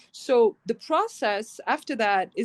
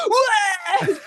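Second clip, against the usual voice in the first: high squeal of disbelief through a laugh; shocked laughing what; 11 semitones higher, 5 dB louder than usual. Retold or imitated. Son hears boyfriend got the game role, laughing disbelief. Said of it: "And Max goes like".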